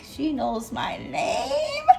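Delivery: high, giddy reenacted amazement; freaking out inside. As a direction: marvel at it, voice climbing into laughter. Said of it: "I'm freaking out inside".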